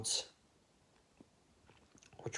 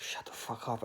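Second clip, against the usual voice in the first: whispered, under the breath; a muttered whisper of annoyance; whispered retort; 9 dB quieter than usual. Retold or imitated. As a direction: hiss it under your breath. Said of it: "I'm like".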